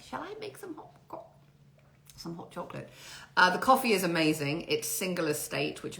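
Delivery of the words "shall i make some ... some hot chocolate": put-on small, soft, high old-person voice. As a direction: play yourself as a cosy old dear, high and quiet. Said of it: "I feel like such an old person".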